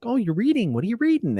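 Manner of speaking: very high, polite small-talk voice; high-pitched mock courteous interest; polite in-laws. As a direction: imitate in-laws making polite conversation. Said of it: "my polite in-laws are like".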